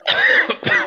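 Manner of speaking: harsh choking cough; coughing fit. Real, not acted. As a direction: cough on a sweet gone down wrong. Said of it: "The Caramello went down the wrong way".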